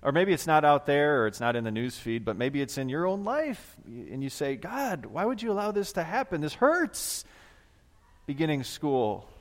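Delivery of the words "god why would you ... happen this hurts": high, pleading cry to God; anguished plea. Acted. Believer asks God about pain in own life, anguished plea. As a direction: plead upward in pain without shouting.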